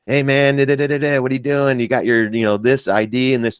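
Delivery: lightly voiced pestering cop; local cop. Cop pulling over a driver for ID, lightly mimicked. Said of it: "they're like".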